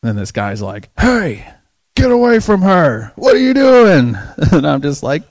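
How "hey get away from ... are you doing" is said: loud, high-pitched confrontation; acted-out indignant holler; imitated angry shout. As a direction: yell like an alarmed bystander stepping in. Bystander confronts him over crying lady.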